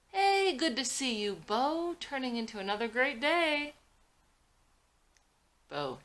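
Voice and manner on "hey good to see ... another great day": loud, high, beaming hello; cheery greeting. Acted. Abe calling a cheerful hello to Bo from the car. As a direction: call out a jolly hello across the lot.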